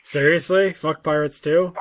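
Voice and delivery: ordinary delivery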